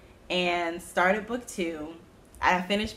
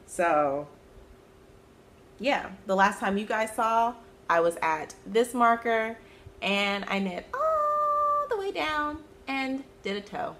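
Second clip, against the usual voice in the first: usual speaking voice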